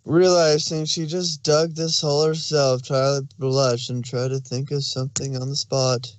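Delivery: ordinary delivery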